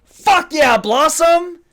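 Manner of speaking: loud, high triumphant cheering; cheering shout. Real, not acted. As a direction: cheer like your team just scored. Cheering the guest's comeback.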